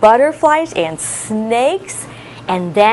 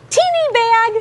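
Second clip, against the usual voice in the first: high tiny voice; tiny high teeny voice; little voice; 12 semitones higher than usual. Shown as a demonstration. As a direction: squeak it like something teeny. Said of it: "in your little voice".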